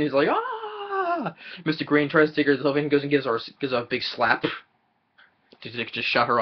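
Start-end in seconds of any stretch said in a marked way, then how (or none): 0.3-1.3 high mock shriek; imitated scream. Mrs Peacock screaming in panic, mock high shriek. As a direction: shriek like a startled guest. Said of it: "She starts screaming".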